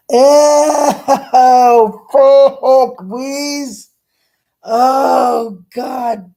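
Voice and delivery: loud, high wail of revulsion; disgusted shriek. Real, not acted. Vlogger reacting to spider nest behind a bed, disgusted shriek. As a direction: squeal out your horror at full pitch.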